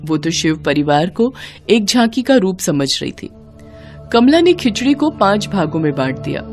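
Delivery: plain reading voice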